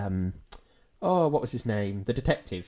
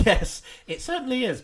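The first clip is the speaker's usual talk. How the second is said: mocking echo; 10 semitones higher than usual. Mocking echo of co-host's words.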